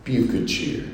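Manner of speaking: half-whispered, no conviction; weak, downhearted. Shown as a demonstration. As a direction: say it like you've given up. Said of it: "Instead of a weak, downhearted response".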